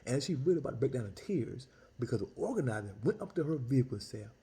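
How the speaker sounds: ordinary talk, nothing marked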